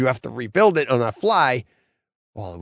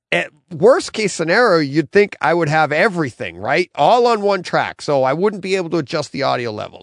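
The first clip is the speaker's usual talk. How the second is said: ordinary talk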